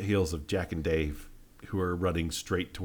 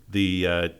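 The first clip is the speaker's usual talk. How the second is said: ordinary talk, nothing marked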